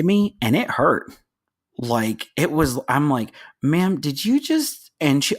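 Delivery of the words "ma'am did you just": slow, higher mock-startled question; pitched-up disbelief, trailing off; taken aback. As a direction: act out a startled protest, drawn out. Retold startled reply to woman.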